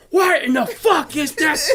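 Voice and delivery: high, drawn-out yell; hammed-up incredulous fury; mock outraged chef. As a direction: blow up over the dish. Imitating chef's outburst.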